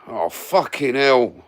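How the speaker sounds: loud, slow, drawn-out startled swear; startled curse. Real, not acted. Rider swearing at a sudden scare, loud and drawn out. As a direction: blurt it out as something catches you off guard.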